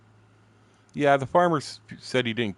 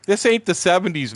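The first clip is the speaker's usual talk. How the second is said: indignant; 11 semitones higher, 7 dB louder than usual. Retold or imitated. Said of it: "they're all like".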